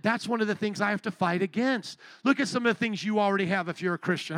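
normal talking voice

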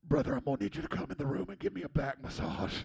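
quick put-on intense pastor voice; comic imitation calling from another room; mock-serious demand in a character voice; Pastor Joe. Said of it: "you hear this in that voice".

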